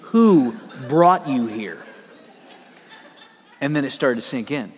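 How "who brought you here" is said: slow, low and gentle; tender question. Retold or imitated. Chaplain to worried student, asking slowly and tenderly. Chaplain holding his head.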